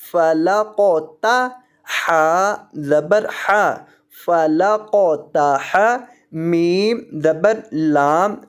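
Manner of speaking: slow, drawn-out Quran teaching chant; syllable-by-syllable spelling drill. Shown as a demonstration. Teacher spelling the verse for students, slow measured recitation. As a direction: stretch each letter name, then join the syllables.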